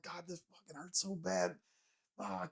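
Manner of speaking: retold pain. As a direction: replay being in pain, very quietly. Hurt man answering his friend.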